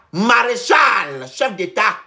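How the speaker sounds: slow, loud, high proclamation; drawn-out, booming mock tribute; mock acclamation. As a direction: proclaim the title like a herald.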